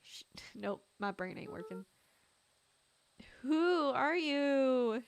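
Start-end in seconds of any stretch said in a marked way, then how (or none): 3.4-5.0 loud, very slow, drawn-out demand; demanding challenge. Acted. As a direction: challenge a stranger, stretching every word. Confronting the large figure.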